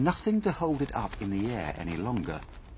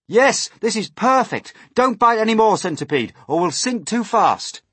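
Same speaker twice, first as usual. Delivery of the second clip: high, raised voice; urgent excited warning; 11 semitones higher, 7 dB louder than usual. A narrator's reading. Warning Centipede against biting more, loud and excited. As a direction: call out approval, then warn urgently.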